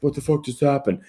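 imitating his baffled question with a slight lisp; mild lisp. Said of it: "with that mild lisp".

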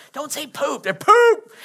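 put-on hush, then shriek the second half like a child; kids squabbling. Imitating kids bickering in church, ending in a high loud yelp.

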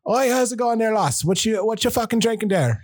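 buddy chatting up a stripper, fake Irish accent. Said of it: "put on a fake fucking accent".

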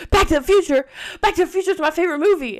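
high, loud and fast delighted gush; excited disbelief. Retold or imitated. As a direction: blurt out your favorite movie. She gets excited about the film.

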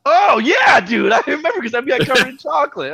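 loud, high excited yell; excited shout. Real, not acted. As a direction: burst out excited, remembering. Excited shout remembering the day.

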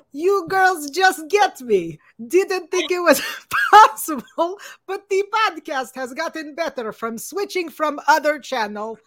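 hammed-up Russian, like a cartoon villain; Russian accent. Host reluctantly reading a fan review, put-on Russian accent.

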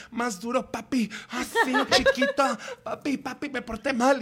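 hammed-up Spanish dirty talk; mock ecstasy, stretched and rising; porn-movie acting. Retelling how she switched to Spanish in bed, mock porn moaning. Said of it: "súper película porno y yo".

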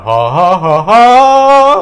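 staccato ha's building to a high loud ha; vocal exercise ha's. Shown as a demonstration. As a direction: push each ha up in pitch and volume like a vocal drill. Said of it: "Some do, like, that".

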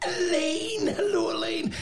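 high, sing-song gushing greeting; Graham Norton impression. Imitating Graham Norton greeting Elaine at a party. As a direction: gush her name way up high like a delighted chat show host. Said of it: "that was a good Graham Norton impression".

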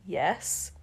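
soft, low, embarrassed yes; quiet bashful admission; mumbled. A narrator's reading. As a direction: mumble it shyly. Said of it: "she mumbled".

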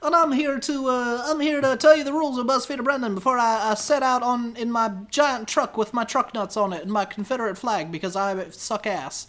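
hammed-up persona losing steam at the end; Juice Chuggins. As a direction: play a cartoonish blowhard, settling down. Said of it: "I'm Juice Chuggins".

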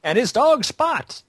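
slow, high announcer sing-song; commercial announcer. Retold or imitated. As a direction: sell it like an old TV ad. Echoing the car commercial slogan.

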